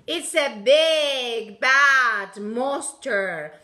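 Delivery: loud, slow monster voice; big bad monster. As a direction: make it sound scary. Teacher describing monster picture.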